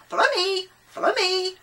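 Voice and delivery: high, loud parrot squawk. A narrator's reading. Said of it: "the parrot flew off squawking".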